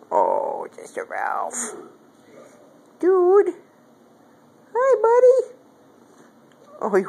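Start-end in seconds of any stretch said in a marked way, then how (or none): 0.8-1.7 breathy whispered aside to the cat; soft whispered pet talk; whispered coo. Real, not acted. Cooing to the cat. 3.0-5.6 excited high pet voice; squeaky pet greeting. Real, not acted. Greeting the cat.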